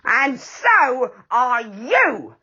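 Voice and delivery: What's-His-Name yelling at sticky Moonface; sudden yell. A narrator's reading. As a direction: yell it as you notice. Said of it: "yelled What's-His-Name suddenly".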